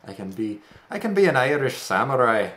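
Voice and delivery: ordinary talk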